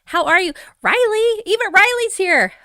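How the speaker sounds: high, loud, delighted surprise; real excited welcome; excited greeting. Real, not acted. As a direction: call out to a friend you're thrilled to spot. Thrilled greeting of arriving viewers.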